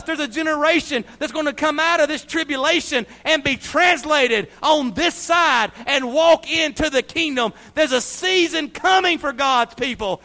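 high, loud, driving sermon delivery; full-force preaching. Real, not acted. Preacher telling the church of the coming kingdom, full-force preaching. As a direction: proclaim it to the congregation, loud and high.

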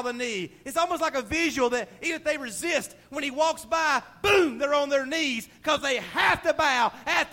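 loud, high fervent preaching; full-force preaching. Acted. Preacher proclaiming God's coming to the congregation, full-force and fervent. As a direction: preach it with all your fire.

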